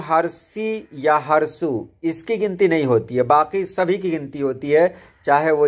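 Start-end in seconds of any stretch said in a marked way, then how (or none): 0.0-1.9 हरसी या Harsu spelled out slowly; teacher drawing out हरसी या Harsu; slow, clear naming. Shown as a demonstration. Teacher slowly naming the short vowel signs for students. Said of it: "मैं आपको बता दूं".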